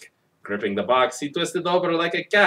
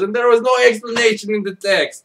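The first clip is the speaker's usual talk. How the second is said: higher, louder exasperated exclamation; indignant outburst; 9 semitones higher, 6 dB louder than usual. Real, not acted. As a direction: throw up your hands at the text. Comic outburst at the text.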